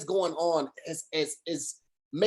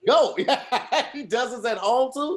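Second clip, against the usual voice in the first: laughing, higher; laughing repeat; 5 semitones higher than usual. Real, not acted. Echoing the co-host's dog joke while laughing.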